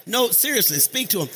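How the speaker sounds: rally the church to greet each other; preaching at full force. Real, not acted. Preacher urging congregation to greet.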